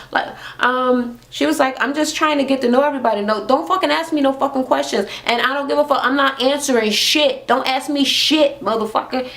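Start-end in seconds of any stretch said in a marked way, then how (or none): 1.8-9.3 high pitched imitation of cursing out; mock belligerent rant. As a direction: act out her fury with attitude. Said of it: "she was belligerent, angry".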